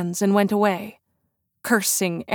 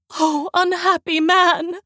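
higher, slow, drawn-out moan; terrified moan; 9 semitones higher than usual. A narrator's reading. Christine moans in terror for Raoul's life. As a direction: mourn him as if already lost.

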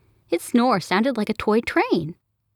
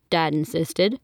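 usual speaking voice

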